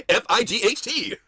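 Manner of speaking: slow, high letter-by-letter recital; kid spelling. Acted. Kids answering the spelling question, slow high voice. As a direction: spell it out like a children's show. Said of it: "Can you spell fight?".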